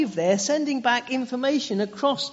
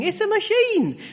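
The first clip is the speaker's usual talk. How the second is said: exasperated retort; 11 semitones higher, 4 dB louder than usual. Real, not acted. Exasperated retort to sentimental mourners.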